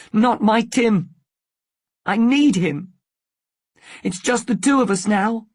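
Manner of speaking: high, loud, distressed protest; a tearful mother clinging to her son; upset protest. Acted. She starts to cry.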